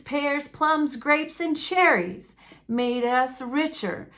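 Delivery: ordinary delivery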